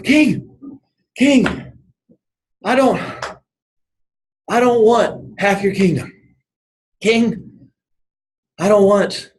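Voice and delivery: high-pitched panting swimmer, acted; halting words as if winded from the swim; imitating out of breath. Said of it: "he's out of breath, and he just can't breathe".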